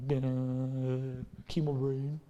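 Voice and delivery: slow dazed groan; groggy bleh. Retold or imitated. Preacher mimicking chemo fog, a dazed low groan. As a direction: sound foggy and sick. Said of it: "and I'm like".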